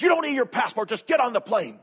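high, loud mock-bickering; exasperated yell. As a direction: imitate a wife snapping back at her husband. Wife argues with panicking husband.

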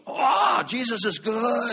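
high, loud, slow joyful call; excited shout. Retold or imitated. Pastor imitates the young man shouting praise, high and loud. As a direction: cry it out with delight. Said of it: "he gets real excited".

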